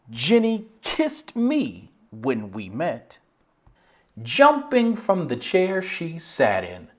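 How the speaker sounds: slow, expressive poem reading, lifted with excitement; annotated poem recital. Shown as a demonstration. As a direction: perform the poem with drawn-out, rising energy. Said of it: "give it a quick run through".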